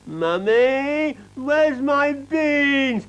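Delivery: put-on high, slow, stretched-out child's voice; demanding little girl. A narrator's reading. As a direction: drag it out like a bratty toddler.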